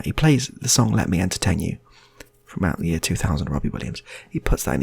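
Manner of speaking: normal talking voice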